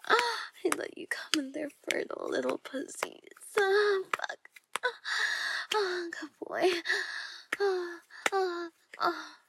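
climaxing with loud crying moans. Acted. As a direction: let the moans climb to a peak. Climax moaning, building.

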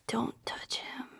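retold warning in a near whisper; menacing mutter. Girlfriend retells silent warning to coworker, hushed menace. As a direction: breathe the threat very softly.

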